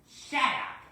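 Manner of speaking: high, loud snapping shut up; angry snap. Acted. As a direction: bite back, stung and cross. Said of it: "said James".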